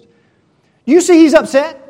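high, very loud, drawn-out pressure; acted guilt-tripping demand; accusing question. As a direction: lean on him loudly. Jezebel pressing Naboth, acted.

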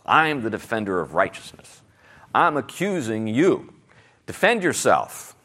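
a hint of a self-righteous accuser pushing you onto the defensive; accusing voice. Accuser's voice acted in sermon.

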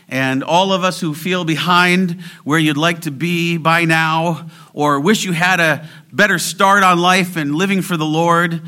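rally the congregation, pressing each phrase; fervent preaching. Real, not acted. Rousing exhortation at full force.

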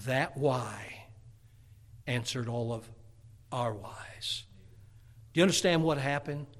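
low, soft, with weighty pauses; hushed, solemn preaching. Acted. Preacher explaining that God forsook God, hushed. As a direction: let each phrase sink in quietly. Preacher dwells softly on the why.

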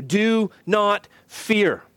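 slow DO, NOT, FEAR, each word set apart; deliberate, weighty prohibition landing on FEAR; emphatic command. Real, not acted. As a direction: pause between the words of "Do not fear". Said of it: "Why do I emphasize this".

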